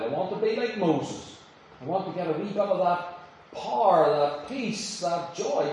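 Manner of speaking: loud, high preaching build to a prayer; preaching at full force. Acted. Street preacher lifting the crowd, preaching at full force.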